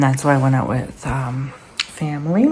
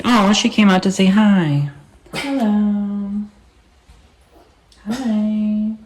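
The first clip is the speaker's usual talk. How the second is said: pet greeting; 6 semitones higher, much slower than usual. Real, not acted.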